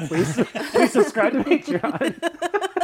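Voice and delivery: high, loud plug through laughter; helpless laughing, slow and loud; laughing plea. Real, not acted. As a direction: beg for subscribers while cracking up.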